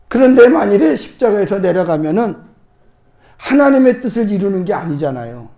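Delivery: usual speaking voice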